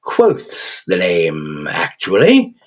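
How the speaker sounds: put-on slow, crooning English accent, gleefully cheerful; a cheerful English creature savouring every word. Raven creature chiding the thug in a cheerful English accent. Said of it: "in a cheerful, slightly English accent".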